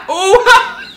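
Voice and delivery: high, loud yelp into laughter; laughing yelp. Real, not acted. Watching a kick in the face, laughing yelp. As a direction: cry out at the kick.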